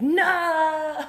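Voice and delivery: high, loud cry of disbelief; dismayed shout. Retold or imitated. Podcaster voicing her friend's dismay, loud shout. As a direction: yell no like your plans just died. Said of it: "And he's like".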